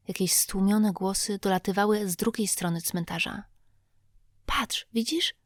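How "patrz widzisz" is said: voiceless whisper, a bit higher; urgent whisper. A narrator's reading. Tom spots lights in the graveyard, urgent whisper. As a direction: point it out without a sound. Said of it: "szepnął Tomek".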